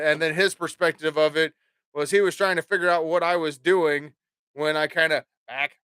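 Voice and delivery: normal talking voice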